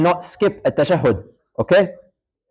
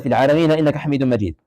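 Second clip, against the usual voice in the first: fast recitation; much faster than usual. Shown as a demonstration. Teacher showing students the tashahhud, fast recitation. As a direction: rattle off the Arabic like daily prayer.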